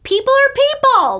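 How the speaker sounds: put-on thick New York accent. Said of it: "She has this thick accent".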